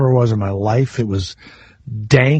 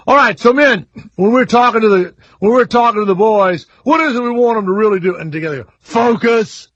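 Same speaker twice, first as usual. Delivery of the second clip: mocking the coach; 7 semitones higher than usual. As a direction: ham up a corny coach rallying his staff. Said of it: "And he sounds like".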